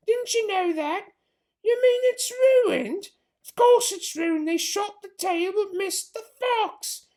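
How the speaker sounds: high, slow, scornful put-on voice; sharp farmer's wife. Missus Bean scolds Mabel from upstairs, high scornful voice. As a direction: talk down to the maid, impatient.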